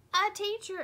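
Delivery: loud, high shout from the whole class. A narrator's reading. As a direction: call it out together, eagerly. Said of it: "everyone responded".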